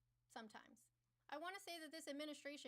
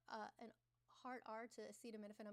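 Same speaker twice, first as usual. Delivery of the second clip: usual speaking voice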